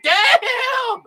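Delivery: shocked exclaim. Retold or imitated. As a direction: yell it out, stunned. Excited exclamation at what he saw.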